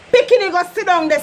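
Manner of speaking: quick mock scold; scolding parent. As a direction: order the kid to sit. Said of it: "sound like verbal abuse".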